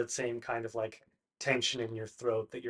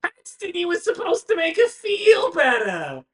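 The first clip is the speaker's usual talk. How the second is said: very high, flustered fretting; Bartleby fussing; 9 dB louder than usual. Acted. Bartleby frets at Paxton over crying Branwen, very high. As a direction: fret high and loud at a friend. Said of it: "He goes".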